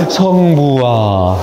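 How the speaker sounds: long, drawn-out 성부와, gliding down; prayer intonation. Shown as a demonstration. Speaker naming the trick for unruly classrooms, drawn-out prayer opening. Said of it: "통제하기 힘든 교실에서 통제하는".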